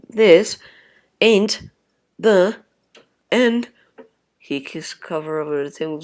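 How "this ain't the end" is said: each word punched loud and separate; staccato, loud defiant vow. Acted. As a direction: spit out every word as a vow. Defiant slow declaration.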